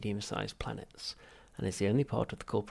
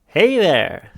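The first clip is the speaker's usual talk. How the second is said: very high, very loud chirpy greeting; perky computer; 9 semitones higher, 19 dB louder than usual. Acted. Ship's computer greeting the machine, perky. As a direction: pop up over-cheerful.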